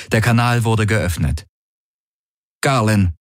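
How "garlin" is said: usual speaking voice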